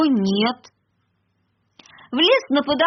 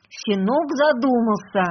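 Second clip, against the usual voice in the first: plain reading voice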